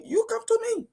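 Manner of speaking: incredulous mock demand. Host mocking a police officer's order, incredulous and high. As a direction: say it as if you can't believe it.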